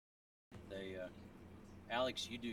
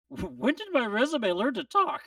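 higher, louder punchline voice; joke character voice; 13 semitones higher, 12 dB louder than usual. Acted. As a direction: play the baffled job applicant.

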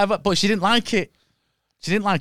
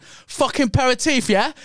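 angry retold quote in a higher-pitched half-Chinese, half-Cockney accent; mock Chinese-Cockney accent, pitched up; imitated Chinese-Cockney accent; 7 semitones higher, 3 dB louder than usual. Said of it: "that's half Chinese and half Cockney".

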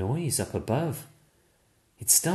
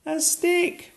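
put-on much higher, gleeful little girl cry; delighted girl; 4 dB louder than usual. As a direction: squeal with delight. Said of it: "cries the girl with a smile".

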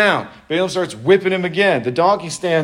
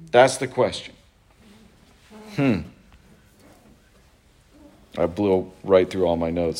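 usual speaking voice

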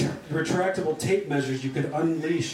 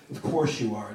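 ordinary delivery, nothing marked

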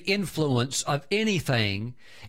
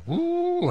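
exultant woo. Real, not acted. Preacher's exultant shout.

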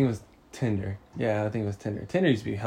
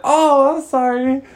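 high, loud mock apology; startled grandma; 9 dB louder, slower than usual. Grandma apologizing after walking in on him, high startled cry.

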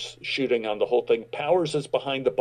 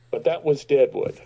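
ordinary talk

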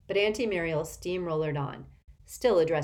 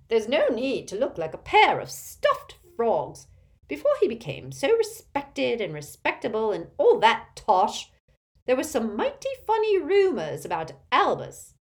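loud, domineering Auntie Muriel character voice; imperious tipsy old aunt; 7 semitones higher, 7 dB louder than usual. A narrator's reading. Aunt greeting Harry at the table, imperious and loud. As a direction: project like a tactless old relative.

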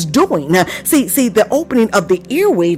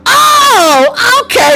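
very high, stretched "oh"; exclaimed realization; 13 semitones higher, 4 dB louder, much slower than usual. Shown as a demonstration. Preacher leads congregation in saying it, exclaimed realization. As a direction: let the understanding burst out.

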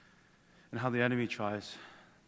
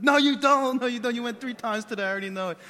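exasperated dad; 11 semitones higher, 10 dB louder, faster than usual. Retold or imitated. Dad refusing kid's bathroom excuse.

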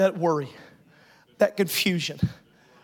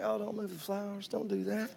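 soft, fussy plea; hush-voiced worried church lady; imitating fretting ladies; 12 dB quieter than usual. Imitating the church ladies fussing over flowers, soft. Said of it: "sweet ladies are cringing, like".

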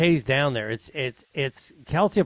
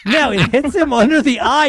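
ordinary talk, nothing marked

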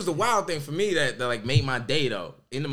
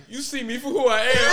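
gushing excitement; 8 semitones higher than usual. Retold or imitated. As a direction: gush like your heart just melted. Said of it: "I was like".

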